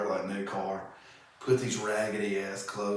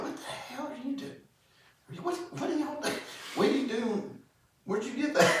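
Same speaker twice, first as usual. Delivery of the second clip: much higher, fast at first, disbelieving; mock shocked acquaintance; faster than usual. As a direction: play a friend who can't believe what he sees. Acquaintance catching him panhandling.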